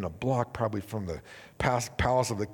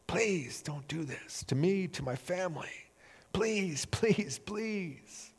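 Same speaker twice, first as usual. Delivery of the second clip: a slow pleading whisper, higher and softer; desperate begging whisper; 5 semitones higher, 6 dB quieter, much slower than usual. Acted. Servant begging the king for mercy, whispered pleading. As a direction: beg for your life in a whisper. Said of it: "he's begging and he's pleading".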